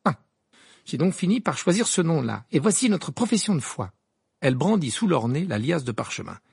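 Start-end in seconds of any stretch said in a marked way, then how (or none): 0.0-3.9 put-on higher-pitched, eager activist girl; fervent Hermione. A narrator's reading. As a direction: explain your cause with zeal. Hermione's zealous speech. (voix d'Hermione, pleine d'ardeur)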